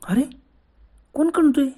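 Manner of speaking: sudden high-pitched alarm at a strange sound; who's that, asked in fright; a jolt of alarm in the dark; startled high question. Acted. Hears groaning on dark road.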